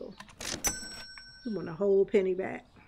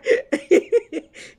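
laugh; 8 semitones higher, 6 dB louder than usual. Real, not acted. Laughing at viewer's comment.

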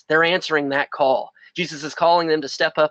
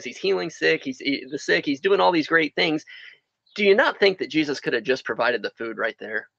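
usual speaking voice

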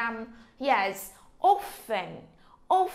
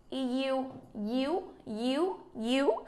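ordinary talk

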